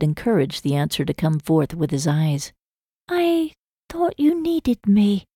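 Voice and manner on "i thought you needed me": high, slow Hannah, shy and earnest; tender confession. A narrator's reading. Hannah tells him she thought he needed her, shy and slow. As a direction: let it out slowly, half ashamed.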